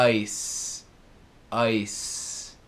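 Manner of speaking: ice said with a hissing S at the end; final S sound. Shown as a demonstration. Teacher showing students the final S, ice with a hissed S. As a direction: ice ending on a clear voiceless S, like "ais". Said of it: "som final como se for um S".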